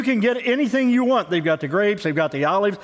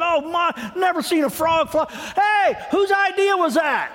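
farm animal calling; 8 semitones higher than usual. Acted. Farm animal shouting up at the flying frog, amazed. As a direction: call up to the sky in wonder.

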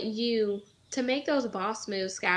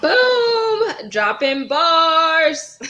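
high, loud, drawn-out cheer; hype shout; 7 semitones higher, 7 dB louder, much slower than usual. Real, not acted. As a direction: shout it out slowly like a rap hype crew. Host hypes the finished rap.

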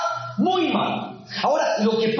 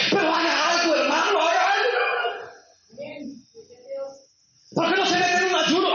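high-pitched, insistent preaching plea; exasperated exhortation; 11 semitones higher than usual. Acted. Preacher urges passive believers to fast, exasperated exhortation. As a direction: urge them at a high, straining pitch.

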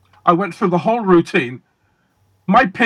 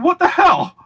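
mock shocked outburst, much higher; baffled listener; 11 semitones higher than usual. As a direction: play an outsider's stunned "what the hell". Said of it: "anybody listening to this conversation now will think".

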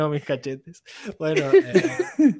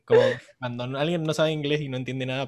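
ordinary delivery

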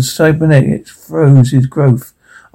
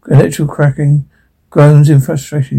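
ordinary delivery, nothing marked

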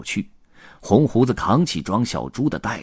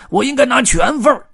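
high, full-volume angry claim; bellowing tramp staking his claim; roar over noise; 7 dB louder than usual. A narrator's reading. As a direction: yell over a clattering train. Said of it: "红胡子的吼声盖过了火车发出的噪音".

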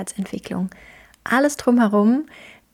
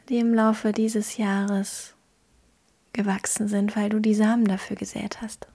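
calm, gentle guiding through a visualisation; guided meditation; 3 dB quieter than usual. Acted.

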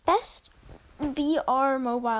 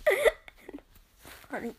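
normal talking voice

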